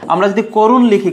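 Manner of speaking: usual speaking voice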